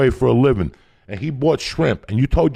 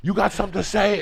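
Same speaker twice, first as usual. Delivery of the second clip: mock angry kid; 7 semitones higher than usual. Comedian voicing a kid cursing out a neighbor's dad, mock angry. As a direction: snap it back at a grown man like a cocky kid.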